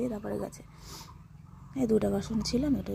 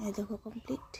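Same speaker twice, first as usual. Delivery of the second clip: whispering; 6 dB quieter than usual. Real, not acted. Quiet aside to viewers.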